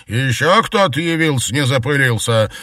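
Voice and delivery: loud, high and annoyed; barked retort. A narrator's reading. Maid answering from the kitchen, barking. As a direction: bark it back in irritation. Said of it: "рявкнула в ответ Марьяна".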